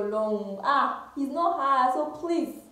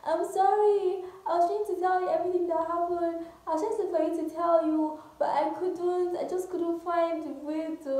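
mock pleading apology; 6 semitones higher than usual. Best friend excusing herself to the betrayed girl, mock pleading. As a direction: imitate a friend making excuses, pitched high.

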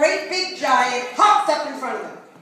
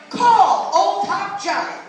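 slow commanding giant; 6 semitones higher, slower than usual. Acted. Giant instructs the brother.

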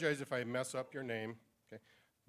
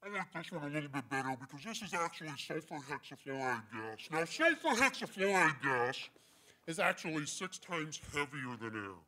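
deep gas-altered timbre, as after inhaling heavy gas; the opposite of a helium voice; sulfur hexafluoride voice. Shown as a demonstration. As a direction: talk through a lungful of heavy gas. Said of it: "it makes your voice a lot deeper".

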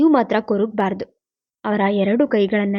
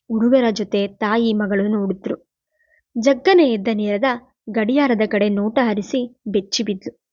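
plain reading voice

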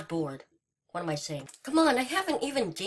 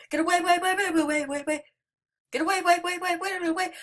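higher, stuttering panicked repeats; terrified horror victim; 8 semitones higher, much faster than usual. Real, not acted. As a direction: squeal the way way way in panic. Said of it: "the weirdest noise I've ever made".

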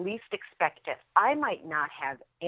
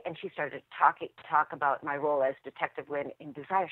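normal talking voice

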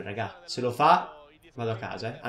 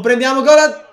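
panicked shout; 9 semitones higher, 12 dB louder than usual. Real, not acted. Panicked defending.

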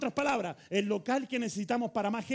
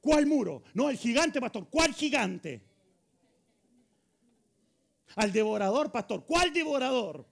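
loud, high, punchy questions; forceful pulpit challenge, drawing out the key question; challenging preacher; 4 semitones higher, 6 dB louder than usual. Acted. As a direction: fire back in scornful disbelief. Preacher challenges imagined fearful believers.

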